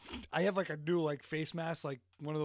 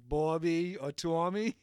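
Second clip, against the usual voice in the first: Boston accent; 2 semitones higher, 8 dB louder, slower than usual. Shown as a demonstration. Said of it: "I can say".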